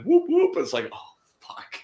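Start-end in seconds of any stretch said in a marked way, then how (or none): 0.0-0.6 much higher siren whoop; siren imitation. Cousin getting caught at the stop sign, siren whoop. 0.9-1.8 breathless whispered oh fuck; whispered curse. Retold or imitated. Cousin seeing the cop lights, whispered curse.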